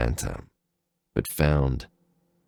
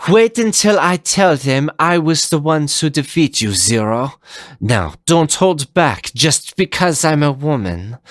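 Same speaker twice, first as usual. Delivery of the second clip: higher, teasing female villain voice; playful taunt; 11 semitones higher, 4 dB louder than usual. Acted. Leviathan teasing Zero before the duel, playful. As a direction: tease him, confident and cocky.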